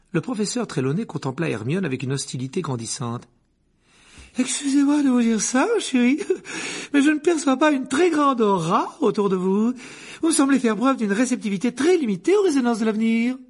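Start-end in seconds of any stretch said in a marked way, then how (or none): 4.4-13.4 high, loud, condescending; haughty seer. Acted. The divination teacher scorns Hermione's lack of aura, haughty. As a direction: talk down to the pupil with disdain. Trelawney hostile.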